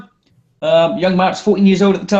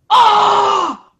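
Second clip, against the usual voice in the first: high, loud shocked crowd cry; imitating a crowd's startled yell; crowd gasp; 14 semitones higher, 4 dB louder than usual. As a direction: shriek like a stunned crowd. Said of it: "everyone in the crowd goes".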